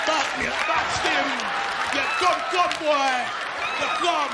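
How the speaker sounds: loud Jamaican patois shouting; hammed-up Jamaican brawler yelling in a club; Jamaican accent. Jamaican men shouting during a club fight. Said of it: "Both them motherfuckers put in the broke back".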